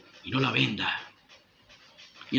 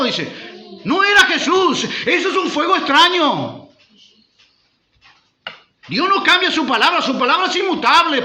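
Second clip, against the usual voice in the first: loud, high impassioned sermon; full-force Pentecostal preaching; fiery preaching; 9 semitones higher, 5 dB louder than usual. Acted. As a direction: preach it hard, pressing the crowd.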